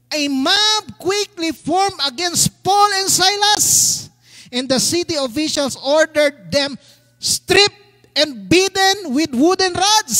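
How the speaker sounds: slow, forceful declaiming; scripture reading. A narrator's reading. Pastor reading of the mob and beating, forceful. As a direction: drive each phrase home.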